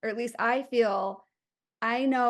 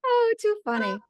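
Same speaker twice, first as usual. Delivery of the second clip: amused; 9 semitones higher, 4 dB louder than usual. Real, not acted. Laughing at the airplane podcast.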